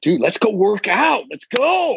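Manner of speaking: acted pep-talk enthusiasm; hyped-up rallying. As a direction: voice leaping high with excitement. Selling a workout to his kids.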